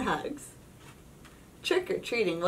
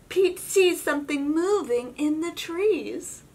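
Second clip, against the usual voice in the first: plain reading voice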